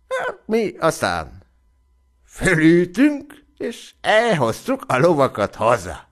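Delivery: earnestly reporting to the squire; naive young soldier. A narrator's reading. Hanesz recounts the story. (enyhén megformált, lelkes egyszerű legény)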